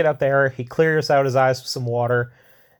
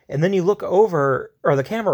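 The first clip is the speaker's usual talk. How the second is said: ordinary delivery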